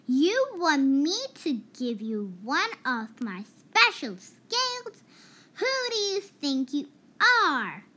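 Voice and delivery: loud, haughty and offended; indignant outburst. A narrator's reading. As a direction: scoff at the nerve of it. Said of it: "cried the Rainbow Fish".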